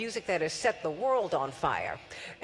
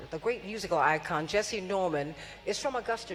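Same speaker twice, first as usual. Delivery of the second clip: ordinary talk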